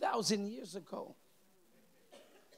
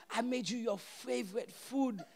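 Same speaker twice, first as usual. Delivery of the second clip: lightly imitated Haitian mother, ending louder; Haitian accent; 5 semitones higher, 11 dB louder than usual. Haitian mom presenting his favorite food, light Haitian accent. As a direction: announce the dish in a hint of Haitian accent. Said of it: "with a Haitian accent".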